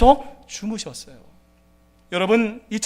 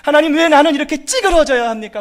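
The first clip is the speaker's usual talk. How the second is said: higher, louder bitter complaint; complaining to God; 5 semitones higher, 5 dB louder than usual. Retold or imitated. A believer complaining to God, bitter and louder. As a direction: whine at God in frustration. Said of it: "불평할 수 있다라는 거죠".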